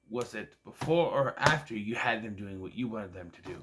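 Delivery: ordinary talk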